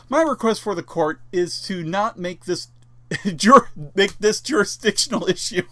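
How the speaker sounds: reading while breaking into laughter; real laughter. Real, not acted. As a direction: try to read on through the laughs. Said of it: "you'll see why I'm laughing again".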